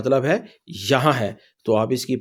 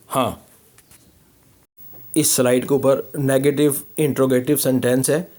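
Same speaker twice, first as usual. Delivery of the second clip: ordinary talk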